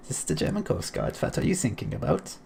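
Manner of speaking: mock German coast guard in a German accent; playful fake German accent; terrible German accent. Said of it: "I should be speaking with the terrible German accent".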